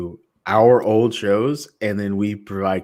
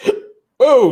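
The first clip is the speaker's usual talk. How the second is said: sharp, much higher startled gasp that breaks off the read; sudden gasp; 14 semitones higher, 9 dB louder than usual. Real, not acted. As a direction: gasp as if caught off guard mid-sentence. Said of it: "excuse me".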